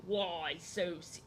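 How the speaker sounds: slow, low villain voice, cut short; Joker impression. Streamer retrying a Batman villain line, deep slow voice. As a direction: draw it out deep, then give up. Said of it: "way too deep and ridiculous for Batman".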